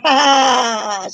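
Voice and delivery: loud, high witch cackle; evil cackle. A narrator's reading. Queen gloating over her poisoned apple, evil cackle. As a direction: cackle with wicked glee. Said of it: "She cackled".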